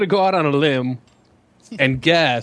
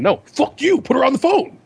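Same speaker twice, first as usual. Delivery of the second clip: high, raised mock yell; mock angry demand; 9 semitones higher than usual. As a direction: push hard for the girlfriend. Kevin demanding on the phone.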